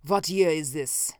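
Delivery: put-on foreign-accented demand; sharp command. A narrator's reading. Strange visitor questions his host, sharply. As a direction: press for the answer, accented and imperious. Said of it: "he commanded sharply".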